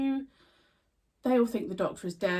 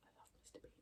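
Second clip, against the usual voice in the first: whispered aside; 31 dB quieter, much faster than usual. Real, not acted. Aside spotting figure on shelf.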